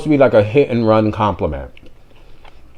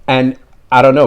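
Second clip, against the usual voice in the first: ordinary talk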